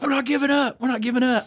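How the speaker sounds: high-pitched stubborn holdout; mock defiance. Inmate defiant, retold.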